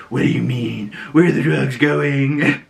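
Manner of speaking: imitated moody, grungy interrogator; Drifter Batman. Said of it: "Drifter Batman is what they called him".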